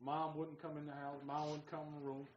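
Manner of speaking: ordinary talk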